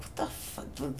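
whispered, baffled aside; muttered disbelief. Retold or imitated. Puzzled by housemates laughing at the movie, hushed disbelief. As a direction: say it under the breath, puzzled. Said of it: "And I'm like".

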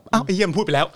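lightly voiced annoyed commenter; imitating a frustrated viewer. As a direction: act out a viewer's irritation. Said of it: "มันก็แบบ".

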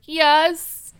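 loud, high mock outburst of a yes; reluctant blurt. [name] fails to hold back his answer, mock blurt. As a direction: blurt it out like a failed attempt at no. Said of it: "He's trying so hard to say no".

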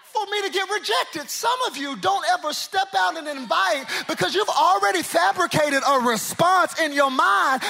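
loud, high preacher's shout; building sermon climax; preaching. Acted. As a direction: drive it home to the congregation.

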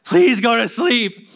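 slow, drawn-out begging; desperate plea. Retold or imitated. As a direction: plead with the baby, worn out. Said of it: "you know".

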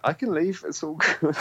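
high, airy fake-nice voice; falsetto mock politeness. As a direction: squeak it out like a polite pushover. Mock-polite self quote, high.

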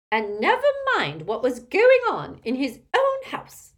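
put-on high, loud, indignant old aunt; squawking. A narrator's reading. Aunt pressing Elphias about Ariana, loud squawking. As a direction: squawk it out with scandalised swoops. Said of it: "squawked Muriel".

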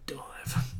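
breathy bitter whisper of the dive. Real, not acted. Fan still sore about Croatia's dive, whispered. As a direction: hiss it like a grudge. Bitter memory of Australia's loss.

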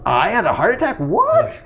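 shocked disbelief. Retold or imitated. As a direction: voice shoots up on the final What, retold shock. Retold waking in hospital, shocked.

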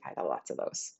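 breathy low aside to the viewers; playful whisper. Real, not acted. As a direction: whisper it like a teasing secret.

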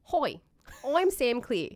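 high, loud broad Aussie greeting; impression of Sam. Friend mimics Sam's Australian hello, broad Australian accent. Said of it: "do an impression of Sam".

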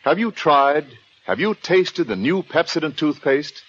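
ordinary talk, nothing marked

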